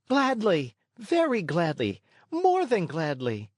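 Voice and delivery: keen, building promise of the puppet; eager puppet boy. A narrator's reading. Puppet boy promising to obey the fairy, high eager voice.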